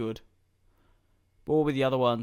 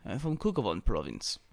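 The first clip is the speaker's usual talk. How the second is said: low, hammed-up Russian accent; badly faked Russian accent; mock Russian accent; 5 semitones lower, 6 dB quieter than usual. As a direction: put on a bad Russian accent for fun. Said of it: "putting on a Russian accent".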